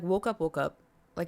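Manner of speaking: normal talking voice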